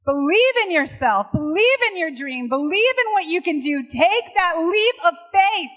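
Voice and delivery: soaring passionate exhortation; rousing call. Real, not acted. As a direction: lift the crowd to their feet. Rousing close to the audience.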